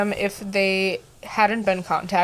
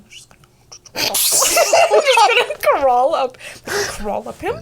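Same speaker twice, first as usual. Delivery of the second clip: creepy demon voice; 11 semitones higher, 6 dB louder than usual. Pretend. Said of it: "And it's like".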